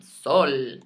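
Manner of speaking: "Sol" firmly, with a pure Spanish O; firm corrective "Sol"; corrected Spanish vowel. Shown as a demonstration. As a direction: say "Sol" with an unglided O and light L. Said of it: "not soul".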